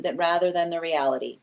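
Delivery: ordinary talk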